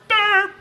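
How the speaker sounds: high, loud comic "derp"; mock falling noise. Mimicking cousin falling over hit, high comic noise. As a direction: squeal out a silly knockout noise.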